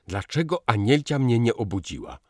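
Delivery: loud angry roar, pitch up; bellowing at the maid. A narrator's reading. Józef roars at the maid for not waking him, loud and angry. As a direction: boom it out at the maid. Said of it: "huknął Józef na pokojówkę".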